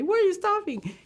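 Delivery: high, anxious question; a boy worried, asking why they stopped. Retold or imitated. Kid asks uncle in van.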